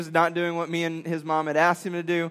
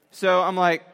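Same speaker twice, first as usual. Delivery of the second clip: usual speaking voice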